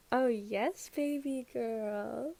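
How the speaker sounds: put-on slow, drawn-out baby talk for a pet; cooing to the dog. A narrator's reading. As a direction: coo it slowly like praising a puppy. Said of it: "Shirabu cooed".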